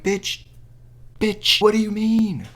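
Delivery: high, drawn-out incredulous scolding; exasperated outrage. Acted. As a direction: snap at her in disbelief.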